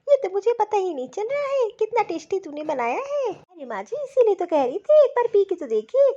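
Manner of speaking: normal talking voice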